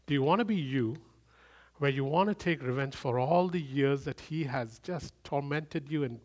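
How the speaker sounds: ordinary talk, nothing marked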